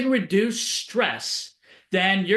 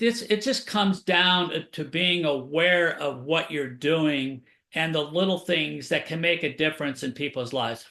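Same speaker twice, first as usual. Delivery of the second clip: ordinary talk, nothing marked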